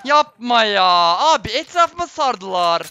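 real frustrated outburst; frustrated outcry. Real, not acted. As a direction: cry out as they surround you. Getting surrounded, outburst.

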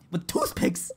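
very high squeak after a laugh; mock anguish. Streamer adding the toothpick detail to chat, mock anguish.

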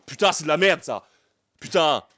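loud exasperated curse; frustrated swearing. Real, not acted. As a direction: snap at the stuck box. Struggling with packaging.

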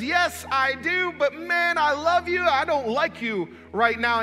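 high, grudging admission; begrudging. Acted. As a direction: concede it while still annoyed. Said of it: "rather begrudgingly".

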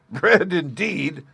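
high, slow mocking drawl; scoffing at the beggar's request; scornful scoff. A narrator's reading. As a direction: drag it out with contempt. Scoffing at begging old man.

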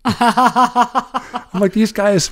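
high, loud giggling exclamation; amused laughing outburst. Real, not acted. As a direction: crack up at them. Laughing at the scene.

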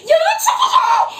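very high thrilled squeal; excited squeal. Real, not acted. Streamer announcing the stream is taking off, excited squeal. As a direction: squeal it out in amazement.

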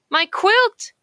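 high, loud cry of alarm. A narrator's reading. Quail calls as the dog grabs his quilt, high alarmed cry. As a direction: yelp as the quilt is taken. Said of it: "cries Quincy".

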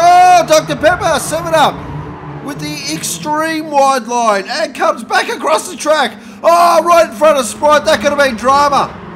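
high, loud shouting at the near miss; excited shout. Acted. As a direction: yell it as the car cuts across. Near crash in the race.

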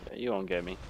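hushed taunt while hiding; being chased. Real, not acted. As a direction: whisper it, you won't get me. Hiding from enemy in game.

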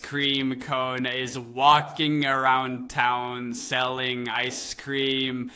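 very slow, drawn out, ominous build; dead slow storyteller voice, as if something sinister is coming; dramatic slow narration. Acted. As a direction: stretch every word like a creepy reveal. Ominous recap of ice cream man.

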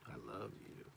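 very soft, hushed I love you; soft inflection. Shown as a demonstration. Speaker demonstrates inflections of love, hushed. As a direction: say it low and barely audible. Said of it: "we use inflections".

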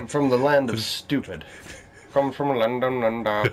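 a silly, put-on London accent; stupid accent. Gamer answering what accent that was, mock London accent. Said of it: "what accent would you call that?".

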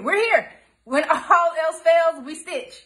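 "We're here" called out high and loud; rally the viewers, lifting "here"; "here" shouted up high, then falling. Shown as a demonstration. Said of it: "repeated rallying".

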